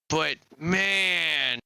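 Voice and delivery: usual speaking voice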